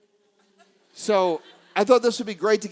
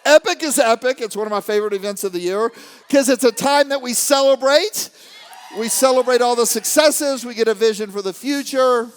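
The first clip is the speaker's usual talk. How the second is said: loud, high, excited stage shout; rally the crowd with full-voiced excitement; hyped-up crowd rouser; 6 semitones higher, 8 dB louder than usual. Real, not acted. Hyping up the crowd at an event.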